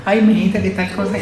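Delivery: high, soft put-on cooing; mock sweet-talk. Shown as a demonstration. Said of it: "Yo no quiero hablarle a usted con".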